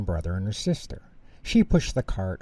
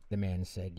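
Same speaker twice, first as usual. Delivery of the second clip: usual speaking voice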